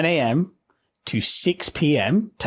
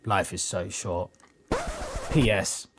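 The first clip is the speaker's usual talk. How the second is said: earnest post reading; 4 semitones lower than usual. Acted. Reading a colleague's grief post aloud, mock earnest. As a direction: read it like a sincere tribute.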